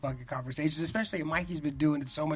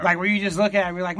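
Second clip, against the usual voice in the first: ordinary delivery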